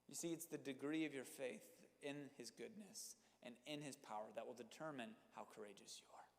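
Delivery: soft dropped whisper. Real, not acted. Preacher whispering the key point to the congregation. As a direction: lean in and whisper it. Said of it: "whispered point".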